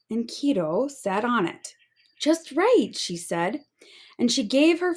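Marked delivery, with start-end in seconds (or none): 2.2-2.9 pleased mermaid. Acted. Happy mermaid in read story.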